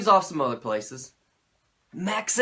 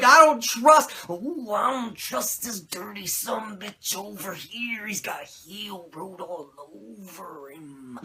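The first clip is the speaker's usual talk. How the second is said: Macho Man impression; 2 semitones higher, slower than usual. Macho asking Sting about the Dungeon of Doom, in a growling Macho Man voice. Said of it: "Macho's like".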